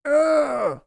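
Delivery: high exasperated ugh; frustrated groan. Real, not acted. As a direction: groan at the bad news. Center leaves draft, groans.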